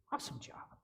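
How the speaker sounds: a hushed, whispered "awesome job". Shown as a demonstration. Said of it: "we simply want you to go".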